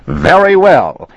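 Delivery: very high, loud furious outburst; angry shout. Acted. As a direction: explode at the agent. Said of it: "he shouted".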